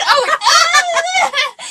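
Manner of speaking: high, loud yelp of fright; jolted-awake shriek, played for laughs; startled mock scream. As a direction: scream as if an alarm woke you. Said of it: "I scared myself awake".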